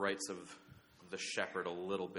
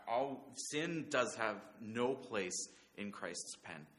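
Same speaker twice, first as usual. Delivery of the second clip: normal talking voice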